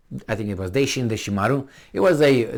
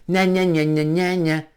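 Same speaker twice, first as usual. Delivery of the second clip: raised-pitch nonsense yammer; mocking gibberish nya-nya; 5 semitones higher than usual. What even supporters hear, high mocking gibberish. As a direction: imitate talk heard as empty noise. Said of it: "are hearing the same thing".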